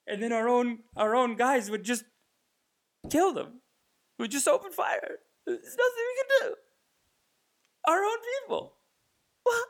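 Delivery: high, hammed-up tearful horror; traumatized veteran. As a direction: build from loud anguish to mock crying. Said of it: "like someone with P T S D".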